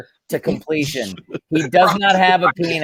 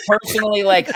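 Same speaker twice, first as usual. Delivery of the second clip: normal talking voice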